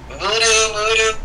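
high sing-song call of his name; mock girls swooning over him; girls calling voodoo. Girls at lunch calling his name, high and sweet.

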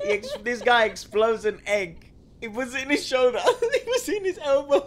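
high and loud, talking through laughter; giggling retelling; laughing. Real, not acted. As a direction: barely get it out for laughing. Retelling a funny video.

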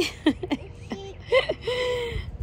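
high-pitched squeal of laughter; a squeaky delighted laugh. Real, not acted. Laughs after pet's reaction. (risa aguda y chillona)

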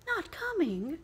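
Bob's spirits dropping; dismayed father. Acted. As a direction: repeat it in sudden disappointment. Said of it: "sudden declension in his high spirits".

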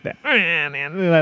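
drawn-out nasal whine, imitating a singer. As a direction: whine it through the nose, stretched out. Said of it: "like, nasal kinda whine".

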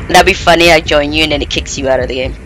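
ordinary talk, nothing marked

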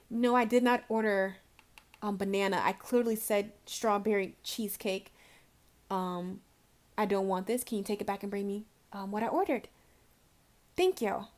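calm, quiet and firm, no apology in it; firm but polite. Retold or imitated. A customer telling the waitress she ordered strawberry cheesecake, calm and firm. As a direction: lightly play a diner who politely sends the dish back. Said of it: "You see how [name] said that".

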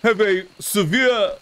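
a campy Transylvanian noble protesting he's not a vampire; Lord Valdemar voice. Acted. Streamer doing his silly vampire character, campy and indignant.

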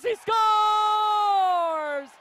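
drawn-out shouted goal call; long goal yell. Real, not acted. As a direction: stretch the goal call out loud.

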